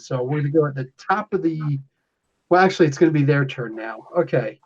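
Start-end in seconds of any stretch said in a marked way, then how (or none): none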